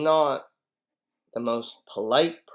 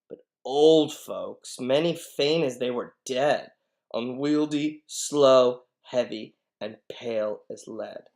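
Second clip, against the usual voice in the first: mocking old folks; 8 dB louder than usual.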